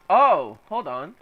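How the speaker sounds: normal talking voice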